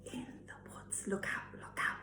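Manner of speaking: very soft whispered refrain. A narrator's reading. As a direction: barely breathe the warning.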